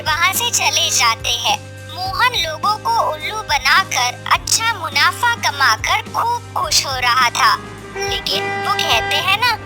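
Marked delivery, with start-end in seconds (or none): none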